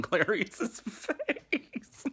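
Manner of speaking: high-pitched cracking up on the words; laughing. Real, not acted. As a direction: break into giggles as you finish. Cracks up at crude film moment.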